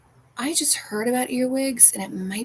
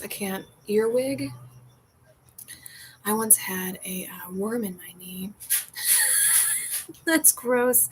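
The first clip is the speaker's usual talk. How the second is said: normal talking voice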